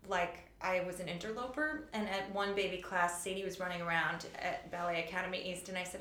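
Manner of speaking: ordinary delivery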